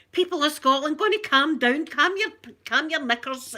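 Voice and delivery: hammed-up plea to the crowd; mock public appeal. Mock address to the nation.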